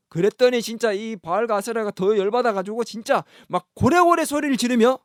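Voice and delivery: ordinary talk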